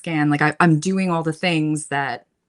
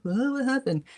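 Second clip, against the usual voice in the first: mock bewilderment; 9 semitones higher than usual. Viewer lost in a TV show, mock bewilderment. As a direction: play losing the plot of a show. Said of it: "Like, I'm like".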